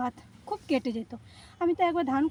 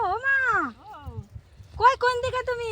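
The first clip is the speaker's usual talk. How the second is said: calling out; 8 semitones higher than usual. Real, not acted. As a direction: call out searching for her. Searching for mother, calling her.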